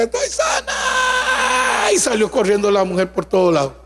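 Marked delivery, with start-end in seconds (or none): 0.0-1.9 very high, long-held cry; drawn-out, high-pitched shriek; retold joyful scream. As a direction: imitate a woman crying out that she is healed.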